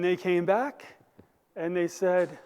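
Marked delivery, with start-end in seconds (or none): none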